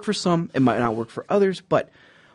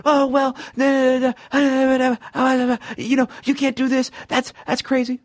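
mock angry customer; 12 semitones higher than usual. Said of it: "If they're like".

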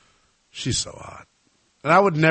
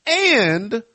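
ring announcer sign-off; 8 semitones higher, 4 dB louder than usual. Pretend. Host announcing a quick timeout, high announcer style. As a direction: send it to break like a ring announcer, stretching the last words.

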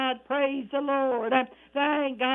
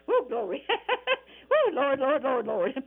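exultant laughing. Real, not acted. Preacher rejoicing at the voice from heaven, laughing whoops. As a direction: laugh out your praise, overcome with joy. Preacher overcome with joy mid-sermon.

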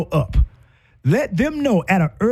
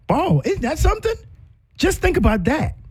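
marveling; 10 semitones higher than usual. Real, not acted. Speaker to audience, marveling and high. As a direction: savor the revelation, lifted high.

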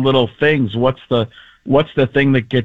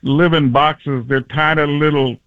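Charles Barkley. Acted. Comedian riffing on tiny little ladies, impression. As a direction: marvel at it in an impersonated voice. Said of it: "the Charles Barkley".

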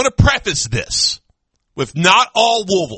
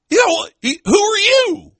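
surprised shout; 13 semitones higher than usual. Retold or imitated. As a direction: blurt it out in sudden disbelief. Retold guy asking who he is.

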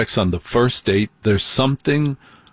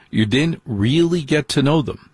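usual speaking voice

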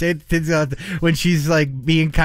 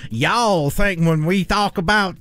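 mock Southern drawl; 3 semitones higher, slower than usual. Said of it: "that's like people saying".

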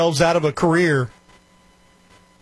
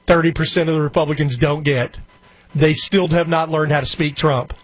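ordinary talk, nothing marked

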